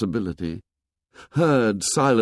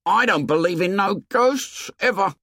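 put-on high-pitched indignant boy; affronted, emphatic child's denial; 10 semitones higher than usual. A narrator's reading. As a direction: protest it firmly, pitch raised. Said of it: "His voice was indignant".

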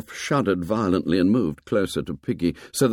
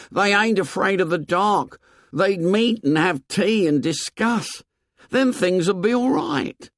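put-on high, earnest boy's voice; high-pitched, wishful conviction; Piggy insisting; 11 semitones higher than usual. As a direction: insist with faith in a high boyish voice.

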